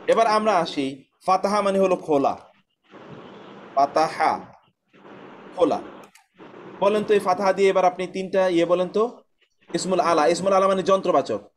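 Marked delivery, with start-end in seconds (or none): none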